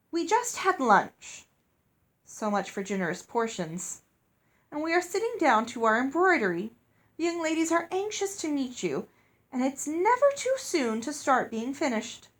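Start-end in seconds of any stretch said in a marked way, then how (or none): 0.1-1.5 prim headmistress. A narrator's reading. Headmistress dismissive. 4.7-12.3 prim headmistress. A narrator's reading.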